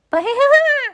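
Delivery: high burst of laughter. A narrator's reading. Laughter after horns.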